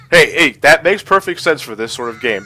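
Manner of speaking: ordinary talk, nothing marked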